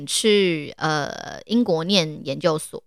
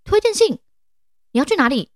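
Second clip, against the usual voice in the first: high, loud astonished question; the professor can't believe it; more surprised; 9 semitones higher, 3 dB louder than usual. Retold or imitated. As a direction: jump up in pitch, loud and stunned. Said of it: "他的表情更惊讶了".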